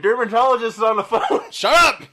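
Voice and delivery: high loud mom call. Pretend. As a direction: announce it like mom. Mom skit again.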